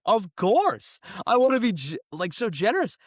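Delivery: high, loud mock eagerness of a dupe; gullible eager victim. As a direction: play the gullible mark, gushing. Gullible people answering the scam.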